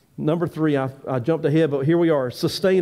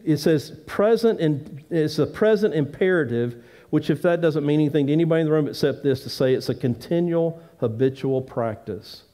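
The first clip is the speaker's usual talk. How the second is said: ordinary talk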